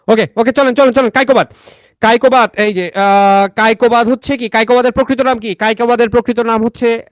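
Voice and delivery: high-pitched teacher rallying the class; emphatic lecture. Real, not acted. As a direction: call the class back to the lesson. Returns to lecture voice. (উঁচু গলায় ক্লাসে ফেরানো)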